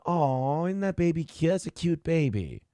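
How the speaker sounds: put-on soft cooing over a baby. Shown as a demonstration. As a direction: gently coo at a cute baby. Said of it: "that's the voice you use for a baby".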